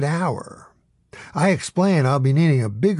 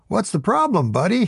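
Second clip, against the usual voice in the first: a lightly voiced smug sidekick, higher; casual, higher needling question; Adam Eget; 7 semitones higher than usual. A narrator's reading.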